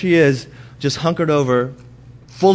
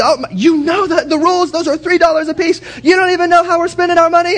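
much higher, louder mock nagging wife; wife's scolding voice; 5 dB louder than usual. Husband mimicking his wife scolding about the budget, high and shrill-loud. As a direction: squeal the rules at him, fast at the end. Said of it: "It turns into".

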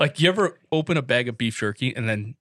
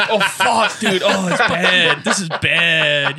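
laughing; 5 semitones higher, 5 dB louder, much slower than usual. Real, not acted. Reacting to a foul smell.

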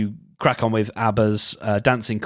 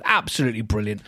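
ordinary talk, nothing marked

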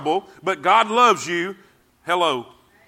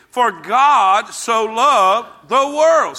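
leading the recitation; 3 semitones higher, 5 dB louder, much slower than usual. Shown as a demonstration. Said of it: "Say that with me".

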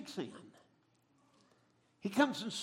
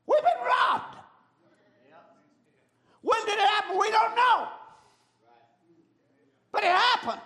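shocked victims; 10 semitones higher, 6 dB louder than usual. Acted. Family discovering robbery.

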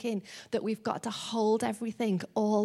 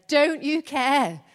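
exasperated plea; 5 semitones higher, 8 dB louder, slower than usual. Acted. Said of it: "and she says".

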